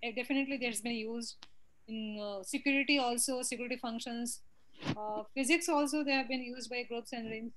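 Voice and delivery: very faint, distant voice; much too soft to carry; barely audible. Real, not acted. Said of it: "Again, very low".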